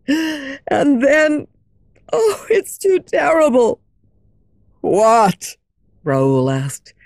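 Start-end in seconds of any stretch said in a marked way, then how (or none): 0.1-3.8 near tears. Acted. Christine breaks down recalling. 4.8-5.6 louder, pained and angry; anguished demand. Acted. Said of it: "his voice full of suffering and anger".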